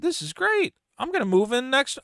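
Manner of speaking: squeaky high excited rush; getting excited. Retold or imitated. Renter telling himself about the apartment, high excited. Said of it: "I start getting excited".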